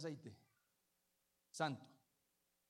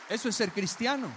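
loud, higher preaching at full force; fiery preaching; 6 semitones higher, 14 dB louder than usual. Acted. Pastor urging the church about God's grace, fervent preaching. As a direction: stir up the congregation with rising fervour. Repeated emphatic declaration.

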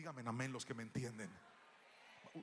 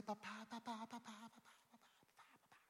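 put-on child calling dad; 8 semitones higher, 7 dB quieter than usual. Child waking dad at dawn.

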